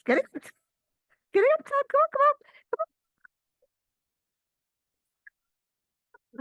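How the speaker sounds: a cat mewing for its owner to wake; cat's voice. Pretend. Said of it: "your cat says".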